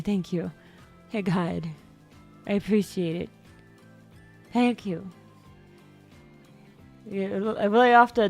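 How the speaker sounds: usual speaking voice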